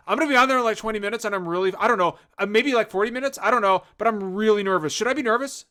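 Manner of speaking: acted jittery oversharing; nervous rambling. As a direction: ramble anxiously, piling up second thoughts. Nervous guest rambling to store owner.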